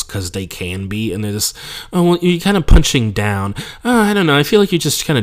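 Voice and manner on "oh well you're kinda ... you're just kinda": much higher, snooty critic voice; imitating Bay Area crowds calling him punching down; mock heckler. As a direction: sneer like a smug audience member.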